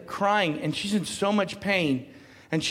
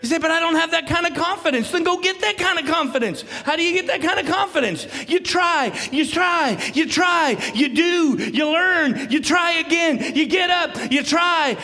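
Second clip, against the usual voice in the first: full-force preaching; 10 semitones higher, 6 dB louder than usual. Acted. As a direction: drive it home to the whole congregation.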